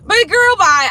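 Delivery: high, loud sassy dismissal. Real, not acted. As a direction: wave them off with sass. Dismissing the critics.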